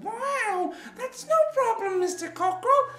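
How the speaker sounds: very high, excited cat; cat character. Acted. Cat recruits cockerel for the band, high excited voice. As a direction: squeal the invitation like an eager cat. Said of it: "And the cat said".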